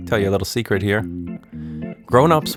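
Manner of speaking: usual speaking voice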